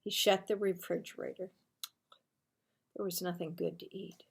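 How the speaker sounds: plain reading voice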